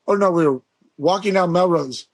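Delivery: normal talking voice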